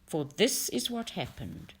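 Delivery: ordinary delivery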